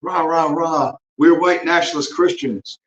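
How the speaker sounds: mock cheerleader. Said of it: "the Thomas seven seven seven cheerleaders".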